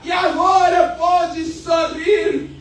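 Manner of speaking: fiery preaching. Acted. Preacher proclaiming freedom to the church, full-force preaching. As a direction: preach it out to the congregation with fire.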